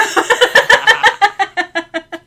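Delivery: big high burst of laughter; loud laugh. Real, not acted. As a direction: laugh out hard. Laughing at her own story.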